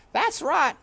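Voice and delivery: high, loud scornful housekeeper; grim maid Louise. A narrator's reading. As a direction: snap it like a tough housekeeper. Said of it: "said Louise".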